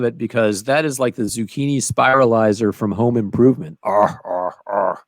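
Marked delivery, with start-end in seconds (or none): 3.8-5.0 deep caveman grunting arr; low growling arr arr arr; Tim Allen grunt. Retold or imitated. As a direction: grunt it like a tool-happy handyman. Home Improvement grunt imitation.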